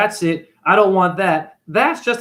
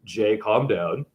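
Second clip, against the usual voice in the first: calming himself down; 8 semitones lower, 5 dB quieter, slower than usual. Acted. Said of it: "use your Buddhist voice".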